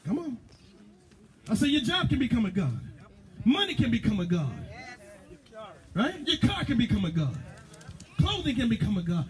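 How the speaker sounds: high, fervent preacher's cry; preaching. Acted. Preacher rousing the congregation, fervent preaching. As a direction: preach it out full force to the church.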